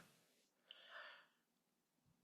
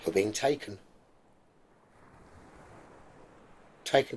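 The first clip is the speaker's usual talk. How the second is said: guided meditation; 2 semitones lower, 4 dB quieter than usual. Acted. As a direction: lull them gently toward the island.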